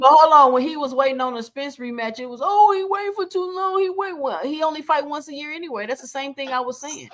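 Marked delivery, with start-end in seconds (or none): 2.4-5.9 high, mocking voice of the critics; mocking whiny quote. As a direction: whine it like a complainer. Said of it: "Everybody was crying".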